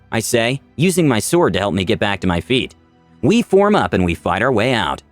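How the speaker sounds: usual speaking voice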